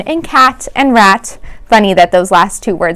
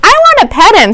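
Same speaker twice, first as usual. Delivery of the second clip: put-on much higher, eager little girl voice; eager child; 12 semitones higher, 4 dB louder than usual. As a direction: beg to pet the frog, high and keen.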